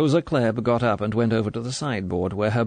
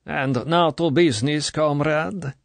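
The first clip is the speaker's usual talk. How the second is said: high, firm official's turn to business; authoritative; 6 semitones higher than usual. A narrator's reading. Colonel turning to business, authoritative. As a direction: take command again. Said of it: "Authority was back in the voice".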